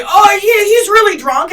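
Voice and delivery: high, loud excuse for the friend; friends' excuse. Retold or imitated. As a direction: brush it off loudly. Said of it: "they're like".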